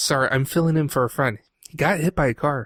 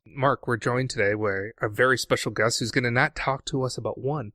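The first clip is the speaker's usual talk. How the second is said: ordinary talk, nothing marked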